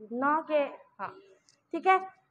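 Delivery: ordinary talk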